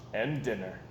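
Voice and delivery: ordinary delivery, nothing marked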